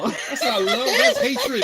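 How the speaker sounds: shrieking with laughter, high and loud; laughing. Real, not acted. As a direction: laugh it out in outrage. Rose thrown, group laughing.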